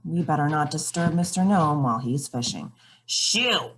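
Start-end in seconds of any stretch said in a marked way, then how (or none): 3.1-3.7 grumpy shoo. Acted. Gnome shoos readers away.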